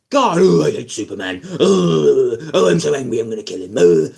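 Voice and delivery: mock-whiny brooding Batman, a hint of a cry; mopey Batman. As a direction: sulk and moan like a mopey hero. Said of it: "Batman was just such a mopey arse".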